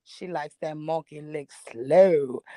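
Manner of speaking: low, slow seductive voice; slow sexy voice. Retold or imitated. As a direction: drag it out low and sultry. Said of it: "he said it in a slow, sexy voice".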